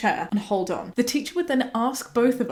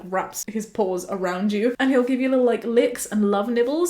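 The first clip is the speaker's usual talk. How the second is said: ordinary talk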